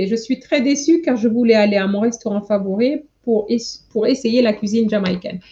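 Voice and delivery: fast, run-together read of the finished sentence; speedy recap of the whole line; quick read-through. Shown as a demonstration. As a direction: rattle it off now that it's written. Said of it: "This is what I was trying to write".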